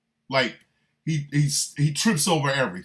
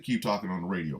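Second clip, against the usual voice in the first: usual speaking voice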